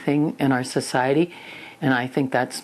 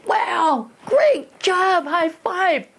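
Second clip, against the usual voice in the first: high, gushing praise; excited parent; much slower than usual. Shown as a demonstration. Parent praising child's first made bed, excited. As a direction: act thrilled, voice leaping up high. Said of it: "you gotta get excited here".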